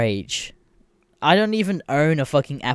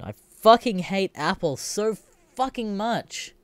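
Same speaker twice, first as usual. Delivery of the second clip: higher, slow, strained venting; exasperated hate; 8 semitones higher than usual. Real, not acted. Venting about Apple, slow exasperated hate. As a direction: grind out the hatred.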